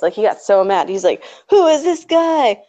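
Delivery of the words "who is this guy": higher, slow, indignant outburst imitating the furious star; playing the insulted hockey star, higher and drawn out; mock outraged. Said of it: "the guy got so pissed".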